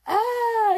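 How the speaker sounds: loud, high scream of fright; scared yell. Acted. Boy screaming at the bear behind him. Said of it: "yelled Donovan".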